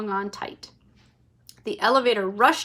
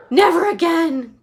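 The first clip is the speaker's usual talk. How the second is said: louder, higher gasped exclaim; 7 semitones higher, 8 dB louder than usual. A narrator's reading. Missus TV stepping off the ride, gasped relief. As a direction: gasp it out. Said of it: "gasped Missus T V".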